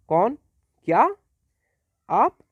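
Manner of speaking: each word thrown up high in surprise; surprised exclamations. Shown as a demonstration. Teacher demonstrates surprise words to students, with rising astonished tone. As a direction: cry out कौन क्या आप like a startled question. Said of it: "तीनों surprise element हैं".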